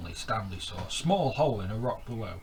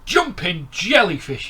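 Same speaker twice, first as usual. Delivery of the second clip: much higher, loud delighted squeal; 10 semitones higher, 14 dB louder than usual. Acted. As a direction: squeal it out in amazement. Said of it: "he squealed".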